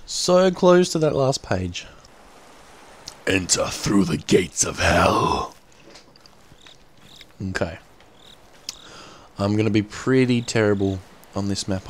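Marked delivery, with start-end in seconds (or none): 3.2-5.5 ominous announcer. Pretend. Ominous intro to the horror game.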